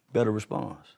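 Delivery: ordinary talk, nothing marked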